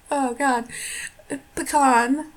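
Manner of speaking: ordinary talk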